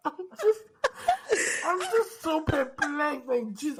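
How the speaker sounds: normal talking voice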